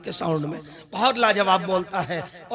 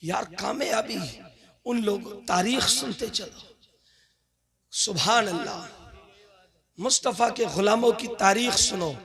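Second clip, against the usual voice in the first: hushed sermon storytelling; 5 dB quieter than usual. Acted. Preacher beginning the history of Bilal the servant, hushed storytelling. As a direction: draw them in quietly, phrase by phrase.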